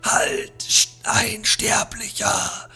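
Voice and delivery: high, unvoiced eerie whisper; shadow's cry. The shadow warns the mortal, eerie high whisper. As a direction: hiss a warning like a spirit. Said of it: "welcher schrie".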